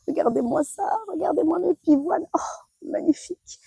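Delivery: hushed, awed whisper; breathy whisper. Real, not acted. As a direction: whisper it in awe. Admiring her peonies.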